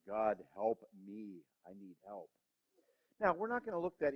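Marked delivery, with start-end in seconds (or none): 0.1-2.3 slow, soft, pleading prayer; desperate quiet plea. Real, not acted. The preacher begging God for help, slow and soft. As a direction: whisper it to God, drawn out.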